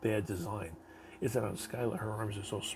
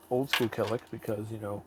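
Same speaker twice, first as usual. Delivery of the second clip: ordinary delivery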